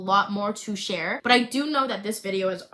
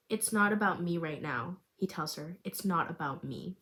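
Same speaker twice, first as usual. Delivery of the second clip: gentle reassurance; 4 semitones lower, 7 dB quieter than usual. Acted. Finn comforting Cassidy.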